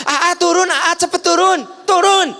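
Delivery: high, loud, urgent yell; shouting warning of a fire; panicked shout. Retold or imitated. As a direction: scream at them to get out. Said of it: "ada kebakaran gitu".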